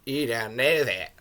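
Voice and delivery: put-on loose Australian accent drifting toward South African; Australian-ish character voice. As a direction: put on a rough Australian accent with drawn-out words. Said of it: "It's a little bit of an Australian accent".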